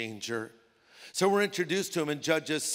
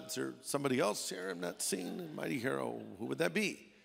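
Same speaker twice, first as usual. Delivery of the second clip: soft, bewildered questioning; puzzled aside; 3 semitones lower, 8 dB quieter than usual. Acted. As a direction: wonder quietly who could be meant. Gideon baffled at being hailed.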